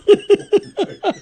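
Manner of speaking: a real laugh after the insult; high laugh. Real, not acted. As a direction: burst of high cackling laughter. Laughs at own rant.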